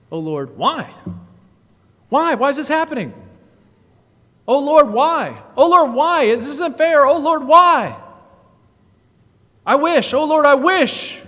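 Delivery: loud, high whining cry of why; hammed-up self-pitying wail at God; discontented complaining. Prideful discontented heart complaining to God, loud whining. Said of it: "discontented heart would say this".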